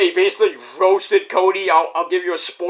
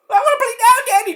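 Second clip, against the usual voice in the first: put-on much higher nagging child voice; whiny kid; 3 dB louder, faster than usual. Kid pestering sore daddy to play, high and whiny. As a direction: whine like a bored toddler.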